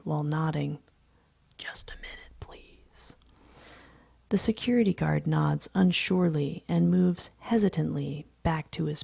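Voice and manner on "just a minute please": barely voiced, very soft whisper; mouthed whisper. A narrator's reading. Narrator puts off the guard, silently mouthing. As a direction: mouth it with only a trace of breath. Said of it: "mouth, holding up a hand".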